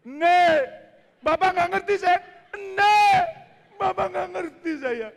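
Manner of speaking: put-on loud, high, slow shouting child; screaming toddler who is not understood; child's frustrated shout. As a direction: yell it as the frustrated son.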